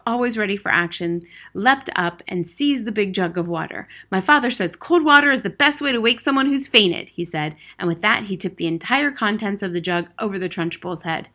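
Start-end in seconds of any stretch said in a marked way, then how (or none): 4.1-7.1 put-on higher, louder boy's voice; keen, raised young voice; eager schoolboy. A narrator's reading. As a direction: pipe up confidently with the remedy. Eager boy announces his remedy.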